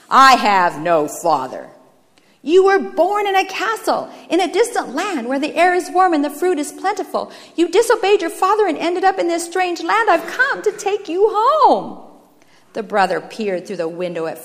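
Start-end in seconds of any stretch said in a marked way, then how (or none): 0.1-1.7 low, loud, slow denial; emphatic lowered-pitch rejection; cold disowning. Acted. Brother disowns his father. 2.4-12.0 high, loud, urgent appeal; rescuer's rising, fervent entreaty; impassioned firstborn. Acted. Firstborn pleads with brother.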